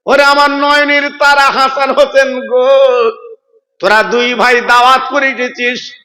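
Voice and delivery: ordinary delivery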